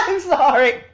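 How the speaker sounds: breaks into a high laugh, then apologizes. Real, not acted. Reader breaking up mid-story, laughing apology. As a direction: crack up and say sorry.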